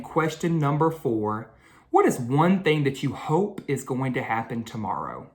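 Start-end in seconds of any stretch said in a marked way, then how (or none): none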